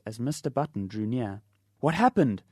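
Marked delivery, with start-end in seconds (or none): 1.8-2.4 rushed, louder and higher demand; breathless demand. Acted. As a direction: gasp it out as you run up. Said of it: "demanded Mister Button as he came up in a gasping rush".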